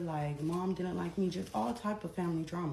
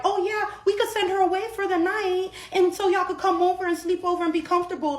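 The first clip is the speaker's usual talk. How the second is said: mock eager family; 11 semitones higher, 9 dB louder than usual. Imitating family's eager offer.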